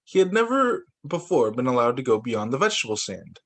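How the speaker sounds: ordinary delivery, nothing marked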